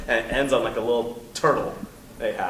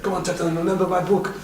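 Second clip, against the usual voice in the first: mock Van Damme urging; 7 semitones higher than usual. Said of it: "stupid jokes where it's like".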